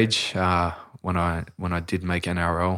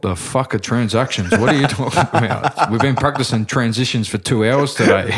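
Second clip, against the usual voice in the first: loud, higher-pitched exasperated coach; mock coach outburst; Wayne Bennett; 4 semitones higher, 7 dB louder than usual. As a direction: imitate an incredulous coach blowing up, close to laughing. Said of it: "That's how he talks as well".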